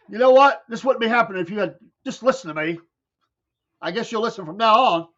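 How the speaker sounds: lecturing dad. Retold or imitated. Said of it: "He was like".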